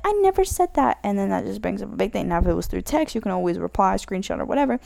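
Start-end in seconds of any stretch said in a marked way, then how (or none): 0.1-1.0 higher-pitched indignant denial; mock denial. Friend denying what they said, mock indignant and higher. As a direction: deny it in a raised pitch. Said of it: "they might be like".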